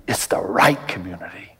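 low, whispery aside; hushed emphasis. Real, not acted. Preacher to congregation on choosing community, hushed. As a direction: drop to a near-whisper to land it. Preacher drives home point softly.

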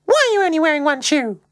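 very high mum voice, questioning; particularly high-pitched voice. Acted. Mum asks Luke about his one shoe, high-pitched. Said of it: "in a particularly high-pitched voice".

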